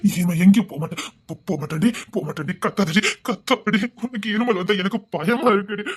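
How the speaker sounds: ordinary talk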